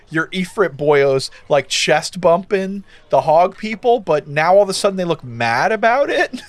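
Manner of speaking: ordinary talk, nothing marked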